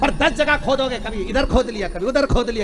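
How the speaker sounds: rising to a loud, high preacher's cry on खोदते जाओ; fervent preaching. Acted. Preacher urging the devotees to keep digging, in a loud, high fervent cry. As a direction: preach it full force, building to a shout.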